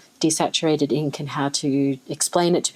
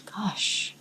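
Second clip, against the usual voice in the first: awed whisper; 3 semitones higher, 8 dB quieter than usual. Real, not acted. Awed at colour.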